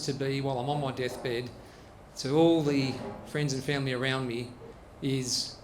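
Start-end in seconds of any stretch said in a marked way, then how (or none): none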